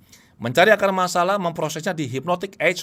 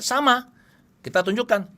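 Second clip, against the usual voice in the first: ordinary talk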